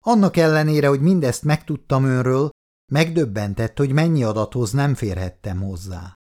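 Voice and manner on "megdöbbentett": plain reading voice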